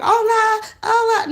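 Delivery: mock sing-song hello, very high and loud; hammed-up cheery greeting to strangers; overly friendly greeting. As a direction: gush hello at everyone like a fake-nice tourist. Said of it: "running around here like".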